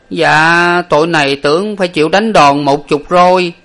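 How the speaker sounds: high, loud servant's whine of contrition; mock-miserable servant pleading for his sentence; tearful whimpering. A narrator's reading. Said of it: "mếu máo mà rằng".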